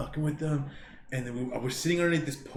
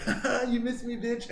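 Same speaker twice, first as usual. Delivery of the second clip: high, singsong gloating taunt; gloating schoolyard jeer; mock taunt; 9 semitones higher than usual. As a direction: rub it in like a cocky kid. Dodges brother's kick, taunting.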